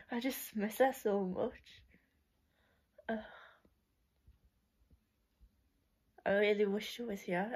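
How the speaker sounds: real sadness. Real, not acted. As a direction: let the grief show quietly. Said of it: "it's just so hard".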